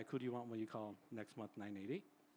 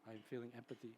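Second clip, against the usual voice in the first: softer, gentle "I'm sad for you"; soft empathy; 10 dB quieter than usual. Retold or imitated. Therapist models empathy for a grieving person, softly. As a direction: quietly show you feel for them. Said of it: "showing in your face".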